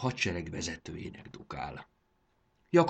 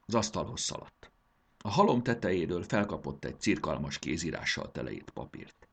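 usual speaking voice

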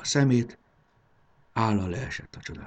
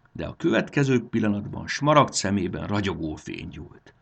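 plain reading voice

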